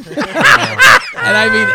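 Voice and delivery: laughing. Real, not acted. Cracking up at the story.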